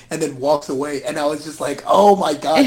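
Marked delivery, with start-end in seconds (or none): 1.9-2.6 excited gasp. Retold or imitated. Excited reaction to great shot.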